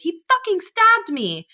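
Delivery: high, slow, raging yell; furious shout. Acted. Jack shouting that he was stabbed, raging yell. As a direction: bellow it out in pain. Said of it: "Jack shouted".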